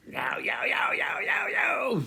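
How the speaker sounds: high shouted yowling; Tasmanian devil yowl. Pretend. Vlogger yowling high as the Tasmanian devil.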